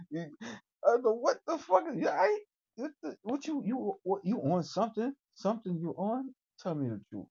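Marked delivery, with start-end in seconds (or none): none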